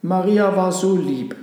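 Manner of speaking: put-on children's voices lifted in an eager call. A narrator's reading. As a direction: call it out eagerly across the yard. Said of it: "riefen die Kinder".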